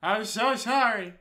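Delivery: high, loud, slow, stretched-out sorry; loud mock apology. Real, not acted. Reacting to the game, a loud, slow, hammed-up sorry. Reading Doki Doki, reacting loudly.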